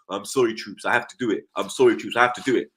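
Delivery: low, subdued mock apology; imitating Alexis. Fan replaying the player's apology to him, lightly imitated. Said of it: "Alexis like this".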